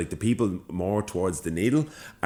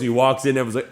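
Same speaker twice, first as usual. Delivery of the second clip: ordinary delivery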